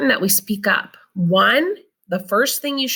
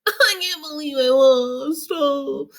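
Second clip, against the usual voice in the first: hammed-up whiny fake crying, higher; mock tantrum; 7 semitones higher, slower than usual. Teacher acting out a child's tantrum after losing, mock crying. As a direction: pretend to sob over a lost game. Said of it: "throw a temper tantrum like".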